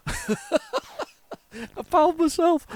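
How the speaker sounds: high squeal breaking into cackles; cracking up at an accidental rude joke; helpless laughter. Real, not acted. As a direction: laugh through the words. Laughs at own wording.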